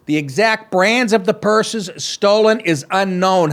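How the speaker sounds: mock anchor reading the story; deadpan read of the news copy. Host reading the heist story to listeners, mock news read.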